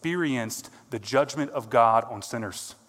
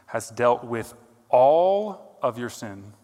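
"all" slowed and pushed louder, the key word of the line; stress on ALL; 5 dB louder, slower than usual. Real, not acted. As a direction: lean hard on "all", drawn out and heavy. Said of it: "I emphasize the word all here".

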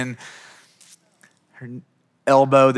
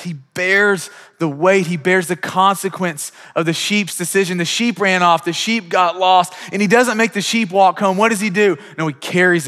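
louder, higher preaching push; full-force sermon voice; fervent preaching; 5 semitones higher, 6 dB louder than usual. Acted. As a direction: preach it hard to the room. Preaching build.

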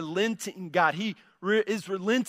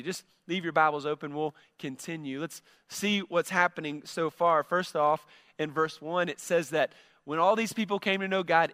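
normal talking voice

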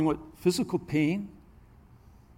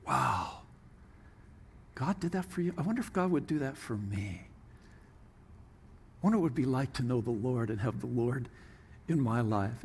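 soft, low musing; acted hushed, low pondering; wondering to oneself; 6 semitones lower, 10 dB quieter than usual. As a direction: think aloud in quiet wonder. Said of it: "until they're thinking".

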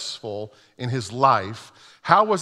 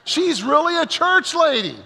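awed thought; 9 semitones higher than usual. Retold or imitated. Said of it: "And I'm thinking, like".

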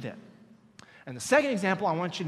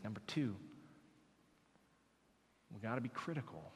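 much softer, low intimate preaching; hushed sermon; 8 semitones lower, 9 dB quieter than usual. Acted. Pastor speaking softly to the church about Jesus' dying words. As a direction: draw them in close and quiet.

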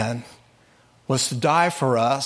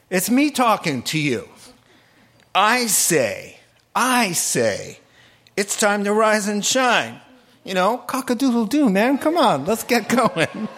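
higher, rousing wake-up call; Jesus waking the dead; 6 semitones higher than usual. Acted. Jesus calling the dead man to rise, higher and playful. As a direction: playfully rouse a sleeper. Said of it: "So here's a paraphrase".

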